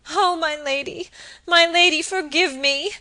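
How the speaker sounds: high, loud sobbing plea. Acted. Phoebe begging forgiveness, sobbing plea. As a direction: plead through tears. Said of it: "sobbed Phoebe".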